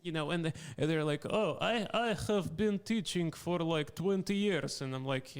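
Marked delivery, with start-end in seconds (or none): 1.3-4.8 higher-pitched mock Russian accent; terrible Russian accent. Russian English teacher boasting of experience, terrible Russian accent. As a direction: imitate a thick, bad Russian accent. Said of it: "like, this terrible Russian accent".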